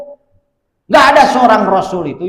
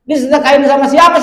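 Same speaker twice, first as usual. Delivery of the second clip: startled question; 8 semitones higher, 5 dB louder, faster than usual. Acted. Kiai questions his son.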